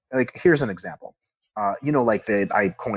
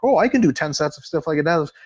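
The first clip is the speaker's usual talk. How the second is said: a hint of a smug gym bro, slightly higher and brighter; mocking boast; 6 semitones higher than usual. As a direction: lightly mimic a cocky lifter bragging.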